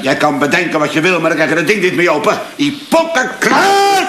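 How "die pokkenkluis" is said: high, slow furious curse; angry curse. Acted. Frustrated man cursing the safe, angry outburst. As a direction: spit out the frustration.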